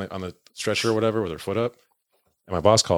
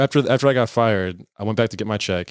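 ordinary talk, nothing marked

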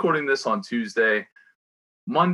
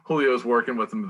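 ordinary talk, nothing marked

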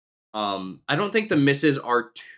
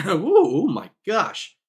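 voice cracking; 8 semitones higher, 4 dB louder, slower than usual. Real, not acted. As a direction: voice cracking up high with a laugh. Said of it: "My voice is all over the place".

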